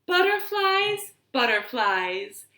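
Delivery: high, bouncy sing-song rhyme voice; reading a rhyme to children. Acted. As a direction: chant it for little listeners.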